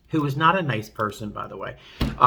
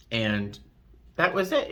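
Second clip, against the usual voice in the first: ordinary talk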